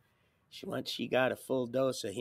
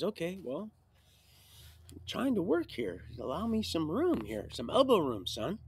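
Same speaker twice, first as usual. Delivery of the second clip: retold heavenly father; 6 semitones higher than usual. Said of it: "He says".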